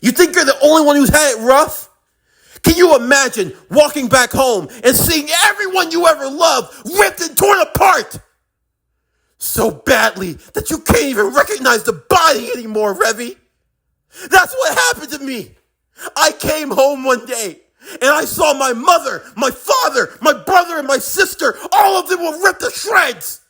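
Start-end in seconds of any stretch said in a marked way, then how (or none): 0.0-11.9 high, shouted accusation; anguished outburst. Acted. Acted outburst over lost family. 14.3-23.4 high shout of grief and rage; anguished confession. Acted. Confesses family was killed.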